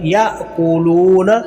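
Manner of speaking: याअकूलूना with a catch after ya and loo held long; jerk and lengthening. Shown as a demonstration. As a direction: slowly recite याअकूलूना, jolt ya, stretch loo. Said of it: "झटके के साथ लाम को लंबा". (याअकूलूना में 'या' झटके से, 'लू' लंबा)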